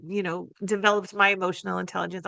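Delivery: usual speaking voice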